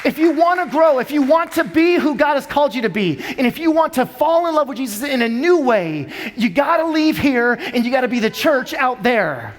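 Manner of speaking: earnest pulpit exhortation; fervent preaching. Real, not acted. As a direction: press the congregation to go. Preaching call to action.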